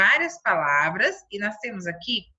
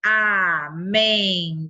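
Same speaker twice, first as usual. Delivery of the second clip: Amendoim split very slowly, a-men-do-im, mouth opening on each; syllable split; 3 semitones lower, 4 dB louder, much slower than usual. Shown as a demonstration. Teacher shows children how to count syllables, very slow. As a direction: open the mouth wide on every syllable of Amendoim. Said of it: "Quantas vezes eu abri a minha boca".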